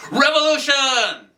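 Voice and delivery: high, drawn-out shout of revolution; loud, high fist-in-the-air cry; rallying yell. Acted. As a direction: yell it like a battle cry, stretching it out. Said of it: "raise your fist in the air and yell".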